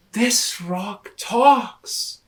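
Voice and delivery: slow, loud stunned words; amazed. Acted. Rabbit tells Lion the rock talks, slow stunned. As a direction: say it in disbelief.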